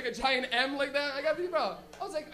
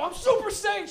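put-on higher, louder boast as his child self; excited kid yell; 8 semitones higher, 7 dB louder than usual. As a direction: imitate a kid proclaiming his power. Kid self declaring transformation.

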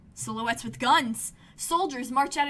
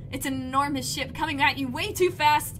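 plain reading voice

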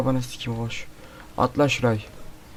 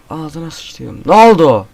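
usual speaking voice

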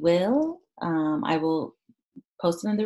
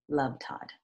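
whispered sign-off; 6 semitones lower, 8 dB quieter than usual. Acted. Teacher whispers the author's closing note to the class. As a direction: breathe it softly like a letter's end.

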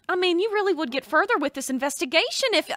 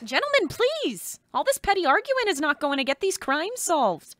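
put-on Minnesotan accent. Woman breaking up the detectives' squabble, in light Minnesotan accent. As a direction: scold them gently in a hint of Minnesotan. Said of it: "say that in a Minnesotan accent".

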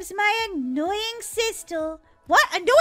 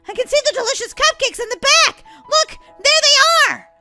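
loud, high protest; desperate; 7 semitones higher, 8 dB louder than usual. Acted. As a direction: insist frantically, stretching the final words. Shop closed, cupcakes in sight.

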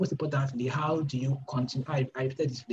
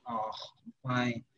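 groggy just-woke-up voice; 2 semitones lower, 6 dB quieter than usual. Retold or imitated. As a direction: sound half asleep answering the phone. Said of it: "Why are you sounding like this?".

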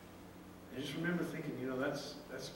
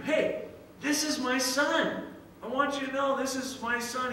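high, slow, louder proclamation; proud father announcing; 9 semitones higher, 4 dB louder than usual. Retold or imitated. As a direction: show off your son to everyone. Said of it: "going around to people".

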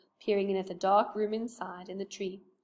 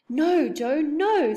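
urgent protest; 7 semitones higher, 9 dB louder, much slower than usual. Acted. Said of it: "said Beth".

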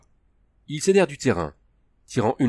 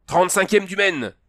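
higher, louder shout into the dark; 10 semitones higher, 5 dB louder than usual. A narrator's reading. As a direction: call out across the docks. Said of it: "hurla une voix dans les ténèbres". (crié fort dans la nuit)

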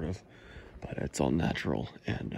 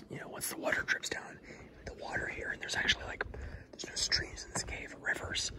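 hushed awed cave whisper; 8 dB quieter than usual. Real, not acted. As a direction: whisper softly, full of wonder.

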